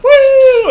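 sudden high whooping yell; loud whoop. Retold or imitated. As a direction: whoop it out at the top. Retelling the dead cheering.

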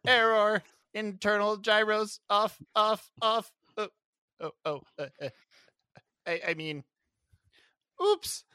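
high, stuttering malfunctioning robot; glitching robot. Pretend. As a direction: sputter like a broken robot that fell. Robot character glitching after fall.

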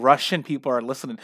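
ordinary talk

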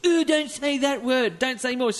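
mock disgust in a high voice; high mock-prissy protest; imitating squeamish women. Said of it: "you go".

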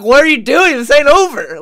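loud, high challenge; angry Vader quote. Retold or imitated. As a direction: shout it as furious Vader. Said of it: "He's like".

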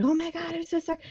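high, breathless imitation of fretful guys; mock apology. Teammates apologizing after clocking her, high mock fretting.

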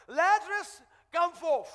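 loud, high commanding shout; Jesus. Acted. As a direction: call out loudly to raise the dead. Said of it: "he, he shouted".

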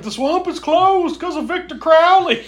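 put-on high, strange old crank voice. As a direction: warn them like a crazy old local. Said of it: "He's like".

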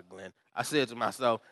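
ordinary talk

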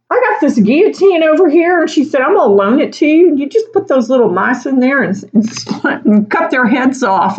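deadpan joke made chirpy; playful sarcastic pitch; mock helpful sarcasm. Mala offers guillotine.

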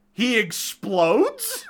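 high, drawn-out disbelief; incredulous. Real, not acted. Host recounting an absurd movie death to co-host, incredulous. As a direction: stretch it out as an astonished, high-pitched question.

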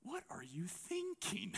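hushed, slow whisper to Jesus; a disciple quietly questioning Jesus in a whisper; whispered aside. Retold or imitated. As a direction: lean in and whisper the doubt. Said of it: "maybe Peter ... being like".

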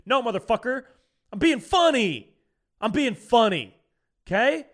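loud, high, heated comeback; fired-up defiant shout; angry retort. Real, not acted. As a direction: snap back hard at the critics. Angry retort to mean commenters.

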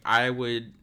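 ordinary delivery

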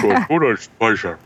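high cartoon duck voice. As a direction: keep the silly duck voice going. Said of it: "But in the duck voice".

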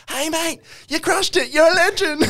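high, overly chirpy praise; mock praise. Telling a comic who bombed he crushed it, high and gushing. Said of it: "you come up and you go".

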